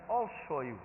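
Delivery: soft grudging mutter; quietly resentful retold aside. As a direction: mutter a threat under your breath. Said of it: "sat there very grudgingly".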